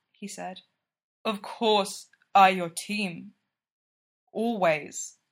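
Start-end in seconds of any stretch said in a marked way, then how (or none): 1.2-5.2 slow, sincere, lightly Russian; gentle Russian accent, slow; tender Russian-accented reassurance. A narrator's reading. Gino reassures Sidney.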